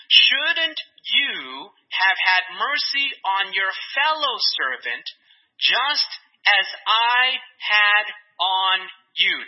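slow, weighted words with pauses on "just as I had on you"; emphatic rebuking question. Acted. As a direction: draw out "Shouldn't you" and space out "I had on you". Reads out the king's key question.

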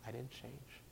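very soft retold denial; hushed, understated quote; softly. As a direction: say it barely above a whisper. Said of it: "he says softly".

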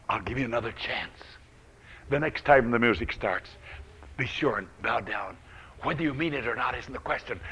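soft, hushed, kindly king; a king speaking softly to his three men. Acted. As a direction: coax them gently, like a forgiving king. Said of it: "he called them very kindly".

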